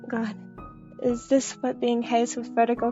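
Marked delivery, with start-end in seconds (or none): none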